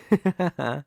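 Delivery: short falling laugh; a brief, amused chuckle; light chuckle. Acted. Amused that the partner was not scared, chuckling.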